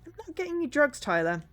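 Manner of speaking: high, indignant refusal; firm refusal. Acted. Manager refuses to get Tyler drugs, indignant.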